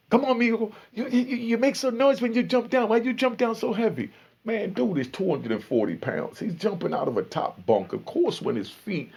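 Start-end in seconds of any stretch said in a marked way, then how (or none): 0.1-4.1 put-on high Spanish-accented broken English. Imitating the Spanish cellmate scolding his bunkmate in a high Spanish accent. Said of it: "trying to talk in broken English and Spanish".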